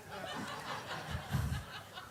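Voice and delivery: high airy laugh at own joke; breathy laugh. Real, not acted. Pastor laughing at his own punchline, airy. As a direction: let out a soft laugh.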